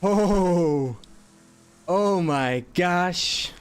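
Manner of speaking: high, loud gasp of disbelief; slow, stunned oh my gosh; amazed. Real, not acted. Reacting to a win.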